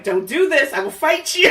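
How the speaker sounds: hammed-up warning to a friend; mock threat. Telling a friend off over hot sauce, in a hammed-up loud threat. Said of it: "And I was like".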